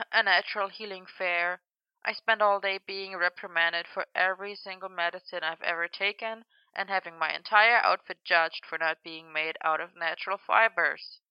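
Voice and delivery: weary sigh, then a very soft complaint; bone-weary. A narrator's reading. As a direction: grumble softly, worn right down. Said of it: "heard Merlin's bone weary sigh".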